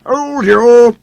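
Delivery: high, loud gloating; triumphant. A narrator's reading. Sir Handel gloating after pushing the rails apart. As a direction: crow it in triumph. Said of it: "said Sir Handel triumphantly".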